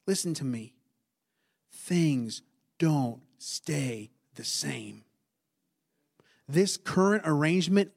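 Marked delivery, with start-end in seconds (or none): 1.7-5.0 low and very slow, spaced out; slow, solemn emphasis. Real, not acted. Preacher telling congregation to listen, slow solemn delivery. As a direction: let each word land separately, lowered voice.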